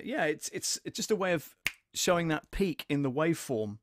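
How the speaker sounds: ordinary delivery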